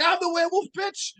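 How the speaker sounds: very high-pitched mock madman; hammed-up manic taunt; acted crazed boast. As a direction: crow it like an unhinged attacker.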